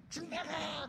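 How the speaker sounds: high, rough, strained cry; angry outburst. Acted. Upset parent ordering the child out of the house, strained angry cry. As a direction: throw the child out in a strained burst.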